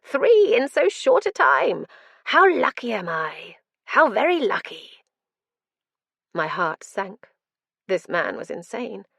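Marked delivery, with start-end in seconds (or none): 0.0-5.0 manic glee. Acted. Madman gleeful.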